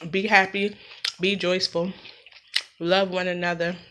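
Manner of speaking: usual speaking voice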